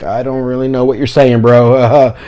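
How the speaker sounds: baffled interpreter. Retold or imitated. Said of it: "your interpreter will look at you like".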